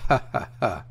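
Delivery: forced hollow laugh of a host; a dry mirthless chuckle; laughing aloud. A narrator's reading. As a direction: laugh at his own joke.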